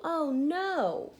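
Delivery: plain reading voice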